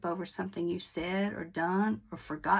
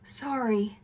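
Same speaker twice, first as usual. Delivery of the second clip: "Sorry" said facing them, gentle, falling and meant; sincere sorry. Shown as a demonstration. Teacher showing students the right way to apologize, sincere and gentle. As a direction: turn to them and mean every bit of it. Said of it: "You wanna turn in their direction and say it".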